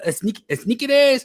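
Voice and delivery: put-on high, questioning Mexican-accented try; desperate high put-on Mexican accent; Mexican Spanish accent. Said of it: "they butchered the American word".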